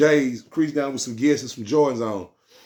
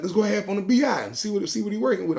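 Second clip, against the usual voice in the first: higher, eager urging; voicing his partner; 10 semitones higher than usual. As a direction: push him to call, pitched up. Partner Dead voiced urging.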